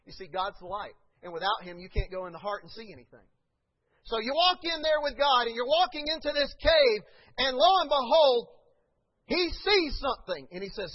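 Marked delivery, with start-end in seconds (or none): 4.1-10.5 loud, high preaching swell; preaching build. Acted. Pastor preaching to the church, loud and rising. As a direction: build it up to the congregation.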